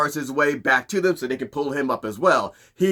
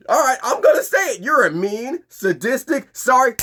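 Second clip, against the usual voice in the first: raised, louder and higher furious rant; angry outburst; 10 semitones higher, 3 dB louder than usual. Retold or imitated. Kilowog finally insults Ermi to his face, angry. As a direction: let it all out at him. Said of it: "so he's like".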